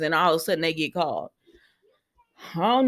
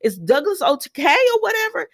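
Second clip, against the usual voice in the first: much higher alarmed gasp of concern; mock alarm; 11 semitones higher than usual. Brooke hearing that CPS came, in high mock alarm. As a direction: play Brooke's shocked worry up high. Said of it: "And she was like".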